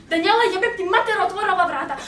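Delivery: loud, fast imitated mom yelling in Bosnian. Mom yelling at her daughter through the bathroom door, loud and fast. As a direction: bark it fast like an angry mom. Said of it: "my mom starts yelling in Bosnian".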